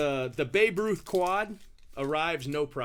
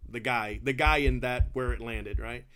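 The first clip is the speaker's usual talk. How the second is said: ordinary delivery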